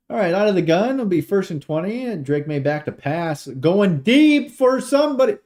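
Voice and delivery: high announcer call surging loud at the end; live play-by-play. Real, not acted.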